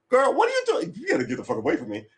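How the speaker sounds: sharp high yelp then a fast brush-off; blunt real talk to a woman. Retold or imitated. Man telling a woman off with a high sharp yelp. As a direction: call her out then rush the rest. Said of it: "It's like".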